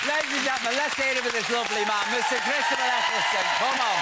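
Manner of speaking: loud, high showman intro; compere announcement. Acted. TV host announcing the guest to the studio audience, loud showman call. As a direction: announce him to the crowd like a compere.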